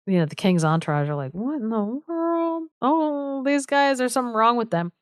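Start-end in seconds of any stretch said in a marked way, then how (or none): 1.3-4.9 mock bewilderment. King's entourage baffled by fools.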